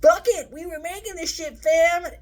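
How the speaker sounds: very high, loud brash outburst; careless Disney execs. Retold or imitated. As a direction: shout it like you don't care. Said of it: "Disney was like".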